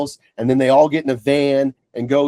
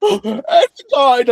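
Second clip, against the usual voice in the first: high, gasping imitation of a sobbing daughter; gasp, then wail it like a crying teen; mock sobbing wail; 12 semitones higher, 5 dB louder, slower than usual. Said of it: "sobbing, screaming".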